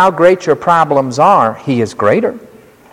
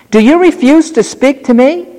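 Pilate demanding; 10 semitones higher than usual. Acted. Pilate questions silent Jesus.

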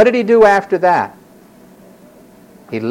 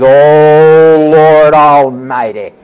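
loud, drawn-out mock-solemn intoning; grand invocation; 3 semitones lower, 8 dB louder, much slower than usual. Hannah opening her bargain with God, grand invocation. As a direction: intone it slowly and grandly to heaven. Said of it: "And here she goes".

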